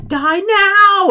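very high, loud, drawn-out wail; menacing amulet command. Retold or imitated. Game master voicing the amulet as it kills, high loud wail. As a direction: cry out a death command in an eerie voice.

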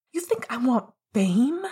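angry retort to his partner; barked back. A narrator's reading. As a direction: bark it back at him. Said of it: "Sirius barked back".

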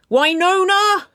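high, loud scolding shout of a name; stern call. A narrator's reading. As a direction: holler up the stairs at a misbehaving teen. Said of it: "Gus's stern voice called from the bottom".

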